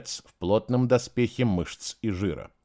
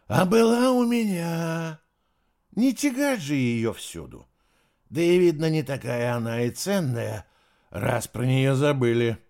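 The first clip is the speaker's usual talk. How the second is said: put-on drunk guard; 3 semitones higher than usual. A narrator's reading.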